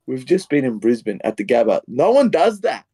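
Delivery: overwhelmed fan, voice climbing high; emotional disbelief. Retold or imitated. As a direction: fan relives an unlikely comeback win, choked up and excited. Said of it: "I started to get emotional".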